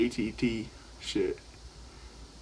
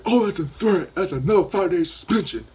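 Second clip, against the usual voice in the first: higher, louder imitation of a stern principal; mock principal; 7 semitones higher, 7 dB louder than usual. Principal declaring a new suspension, high, loud mock-stern. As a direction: play the principal pouncing on a threat. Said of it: "the principal was like".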